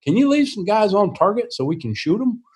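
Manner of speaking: lightly imitated, high-pitched griping; mock complaint. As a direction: playfully protest like a put-out teammate. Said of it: "they'd raise hell with me".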